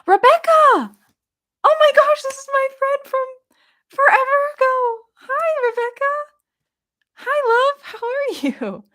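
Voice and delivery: very high, loud joyful greeting; thrilled reunion greeting to a friend; excited squeal. Real, not acted. Greeting old friend in chat.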